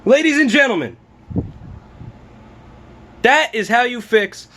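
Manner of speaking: high, grand showman announcement; announcer voice. Acted. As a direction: present it like a ringmaster. Triumphant announcement after fix.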